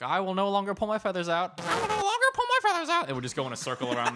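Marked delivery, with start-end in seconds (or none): 0.0-1.6 higher put-on reciting voice; rehab pledge. Leader walks into parrot rehab, mock-solemn raised recitation. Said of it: "one guy would walk in and just say". 1.6-3.1 very high, squawky mock recitation; parrot repeating. Rehab parrots repeat the pledge, hammed-up high bird voice.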